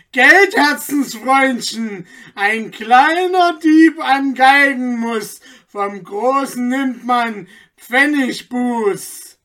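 high, loud and slow mocking verse; taunting call. A narrator's reading. Anton hugging the magistrate tight, slow loud mockery. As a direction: drag out the jeer. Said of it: "umfasste ihn noch fester und rief".

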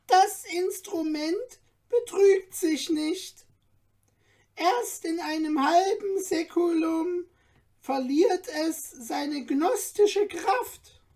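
kindly dwarf reassuring Stefan; friendly dwarf. A narrator's reading. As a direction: reassure him gently, like a kind dwarf. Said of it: "freundlich den Kopf schüttelnd".

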